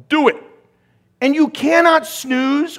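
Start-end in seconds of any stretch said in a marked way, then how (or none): 0.1-0.4 loud high order; firm inner command. Pretend. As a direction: command yourself to act. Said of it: "someone inside has to go".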